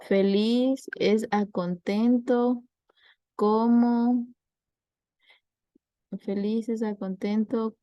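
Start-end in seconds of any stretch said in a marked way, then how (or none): 0.0-4.3 slow quiz reading. Shown as a demonstration. Teacher posing a sample analogy to the class, slow quiz reading. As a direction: read it out slowly like an exam item. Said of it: "O si le preguntan".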